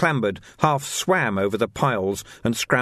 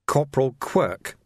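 normal talking voice

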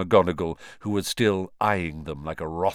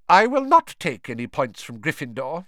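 put-on stern old professor; 6 semitones higher, 5 dB louder than usual. A narrator's reading. McGonagall voiced.

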